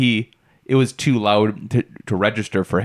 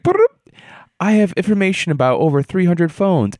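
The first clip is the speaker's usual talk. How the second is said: higher, machine-like assistant voice; robotic Alexa imitation; 7 semitones higher than usual. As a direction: play a chirpy smart speaker.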